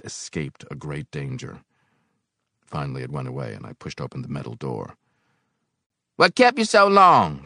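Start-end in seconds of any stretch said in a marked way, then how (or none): 6.2-7.4 loud, high-pitched snap; irritable snap. A narrator's reading. Old boiler man to his late helper, snapping. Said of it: "Brockway snapped".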